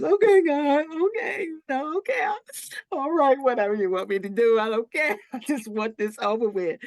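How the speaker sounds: high tearful pleading, voice cracking up; high, teary half-laughing surrender; crying surrender. Retold or imitated. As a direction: plead through tears, giving in. Retelling tearful surrender to God.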